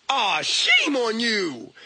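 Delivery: slow, stretched-out reproach; mock scolding. Shaming a porn watcher, mock scolding. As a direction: draw out the shaming.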